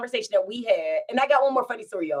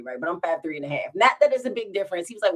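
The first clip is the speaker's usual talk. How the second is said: ordinary talk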